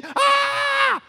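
high, loud, drawn-out scream; acted death scream. Man pushing others into the furnace, screaming as it kills him. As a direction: scream as if the fire got you. Acts being killed by fire.

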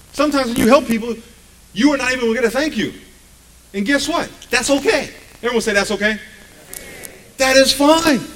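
loud, high, driving sermon; loud preaching. Acted. Pastor urging the congregation not to seek thanks, loud and high. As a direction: lift the church with it.

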